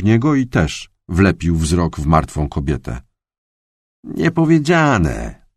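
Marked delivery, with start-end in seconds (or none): 4.0-5.5 high, slow awed voice; stunned colleague. A narrator's reading. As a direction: drag the word out in shock. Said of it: "rzekł".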